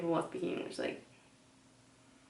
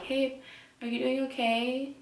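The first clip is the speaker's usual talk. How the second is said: higher, lightly voiced clerk; a hint of a helpful shop clerk; mock friendly knock; mock clerk check-in; 5 semitones higher than usual. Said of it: "they're like".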